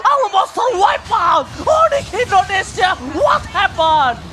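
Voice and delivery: high, slow, stretched hype scream; fighting game announcer. Real, not acted. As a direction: scream the wipe out. Caster screaming at a team wipe.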